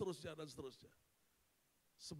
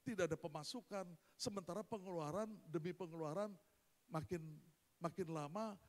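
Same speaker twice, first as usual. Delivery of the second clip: usual speaking voice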